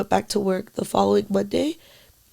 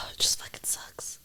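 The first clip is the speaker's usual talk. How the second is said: much softer, sighed-out whisper; weary sighing whisper; 9 dB quieter than usual. Real, not acted. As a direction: sigh then let it fall away. Grieving, sighing in despair.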